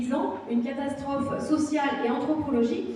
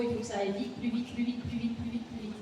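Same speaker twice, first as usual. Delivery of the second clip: much softer, trailing away as it repeats; muttered refrain; 3 semitones lower, 10 dB quieter, faster than usual. Shown as a demonstration. Teacher illustrating the speeding-up of social rhythms, muttered refrain. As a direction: let the repeated words fade low, like an aside.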